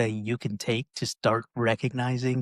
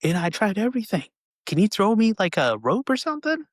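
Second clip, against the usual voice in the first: higher, louder pleading call; pleading from the pit; 9 semitones higher, 5 dB louder than usual. Retold or imitated. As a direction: beg for a rope from below. Trapped man pleads.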